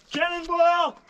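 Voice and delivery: high excited yell; cannonball yell. Real, not acted. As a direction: shout it as you leap. Jumping into the lake.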